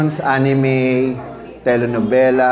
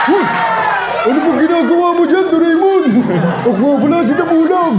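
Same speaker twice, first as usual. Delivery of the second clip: Damulag voice; 13 semitones higher, faster than usual. Shown as a demonstration. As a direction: squeal it at Doraemon. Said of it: "Hindi ako yon".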